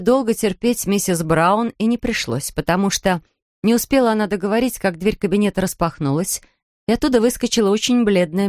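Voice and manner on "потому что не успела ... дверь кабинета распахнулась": plain reading voice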